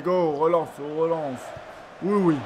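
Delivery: normal talking voice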